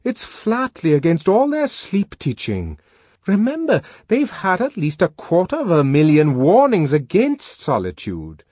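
high, loud, insistent character voice; admonishing friend. A narrator's reading. Bernard voiced, admonishing his friend.

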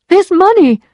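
high, loud and insistent; indignant demand. A narrator's reading. Emma presses the lawyer for money, indignant demand.

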